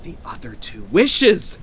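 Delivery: put-on high, urgent old woman; eager reply. A narrator's reading. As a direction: press the idea excitedly, pitched high. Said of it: "she replied rapidly".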